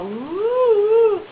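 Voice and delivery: long high swooping whoop; gleeful woo. Retold or imitated. Friend playfully driving her wheelchair, gleeful whoop. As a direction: imitate a joyride whoop, sliding way up. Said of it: "really funny to go".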